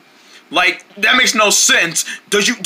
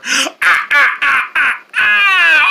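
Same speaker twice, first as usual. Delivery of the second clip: fake sobbing; 8 semitones higher, much slower than usual. Fake sobs before gotcha.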